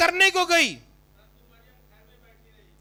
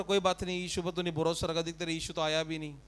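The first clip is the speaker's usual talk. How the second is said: gossiping consoler; 9 semitones lower, 9 dB quieter, faster than usual. Retold or imitated. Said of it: "कोई लोग ये भी बोल रहे".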